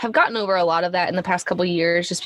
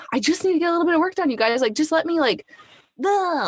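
much higher, fast, exasperated plea; a little frustrated; 9 semitones higher than usual. Retold or imitated. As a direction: rush it like a harried mom at work. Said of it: "like, a little frustrated".

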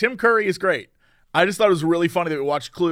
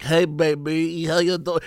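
imitating a smooth producer; 2 semitones lower, slower than usual. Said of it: "His voice is like".

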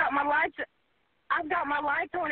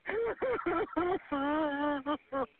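sobbing. Real, not acted. Caller sobbing in flood.

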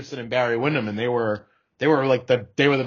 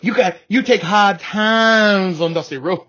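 higher, louder, slow promo voice; wrestling promo; 7 semitones higher, 6 dB louder than usual. Pretend. Mock promo line.

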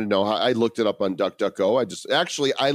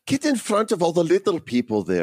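high, hammed-up Irish accent; bad Irish accent; 8 semitones higher than usual. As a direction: ham up a stage Irish lilt. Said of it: "toss in a few bad Irish accents".

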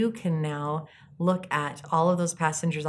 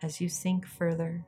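soft spa whisper; 7 dB quieter than usual. Acted. Leads a guided meditation.

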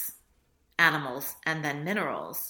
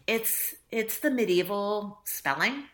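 ordinary talk, nothing marked

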